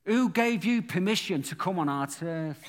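preacher repeating the lad's threat in his own Manchester way; Manchester gang lad. Retold or imitated. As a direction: lightly voice the local hard man's challenge. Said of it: "I've got to do it in his language".

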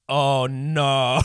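retold dread. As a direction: act out sudden dismay. Retells dread on seeing his friends.

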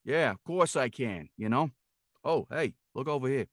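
put-on New York accent; New Yorker accent. Actor proving he can do the accent, New Yorker. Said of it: "like a New Yorker".

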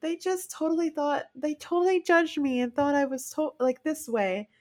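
a mildly hammed-up distressed quote; upset retelling. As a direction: replay how upset you were. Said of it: "And I'm like".

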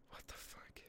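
stunned whisper. Real, not acted. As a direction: breathe it out in shock. Stunned reaction to a figure.

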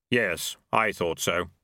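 slow, hollow voice of Death; lightly voiced grim reaper, unhurried. A narrator's reading.

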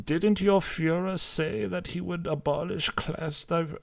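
high-pitched retort, lightly put on; lightly voiced Steiner. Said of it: "Steiner comes back with".

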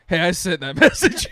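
loud, laughing reveal; confessing the prank to his friend over tacos, laughing; laughing confession. Retold or imitated. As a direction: blurt it out through laughter.

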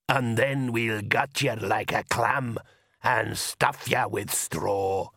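slow, leering soldier threat; menacing soldier. A narrator's reading. Corporal leering a slow threat at the Count. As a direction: leer the threat out slowly.

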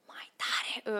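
softly mouthing the words; mouthed whisper. Shown as a demonstration. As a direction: whisper as if signing from backstage.

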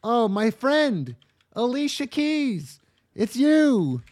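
high, slow, loud gushing falsetto; overjoyed squeal at a long-lost friend; blondie gushing. Retold or imitated. Mock blondie gushes at the ghost, high and loud. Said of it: "And the blondie says".

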